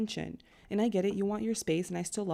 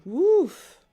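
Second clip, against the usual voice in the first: sharp, much higher and louder woof of awe; 9 semitones higher, 12 dB louder than usual. Real, not acted. Reader reacting to a Scorpio with Gemini moon, loud high woof. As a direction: blurt it out like the chart hits hard.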